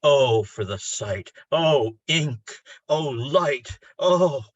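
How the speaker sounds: poem's climax called out; ecstatic cries. Acted. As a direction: build each Oh into a rapturous cry. Climax of the poem.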